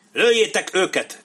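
high, loud officer's shout; shouted order. A narrator's reading. Officer at the ravine orders men to fire, shouting. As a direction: yell the command across a distance. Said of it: "kiáltotta egy tiszt".

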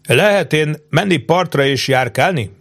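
"Lehet én menni partra" with high, pointed peaks on the key words; tentative, emphatic question. A narrator's reading. Captain tests the chief, "Lehet én menni partra" emphatically pointed. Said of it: "dobta oda próbaképpen és hangsúlyozottan".

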